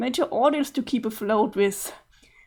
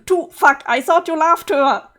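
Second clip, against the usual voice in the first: upset outburst; 4 semitones higher, 6 dB louder than usual. Acted. Upset accusation.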